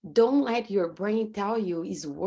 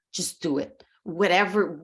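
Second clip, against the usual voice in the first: ordinary talk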